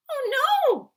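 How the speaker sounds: high, loud dismayed cry; girl crying out. Acted. Sophie crying out at never going home. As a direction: cry out in alarm like a little girl. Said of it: "cried Sophie".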